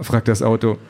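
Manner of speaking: ordinary delivery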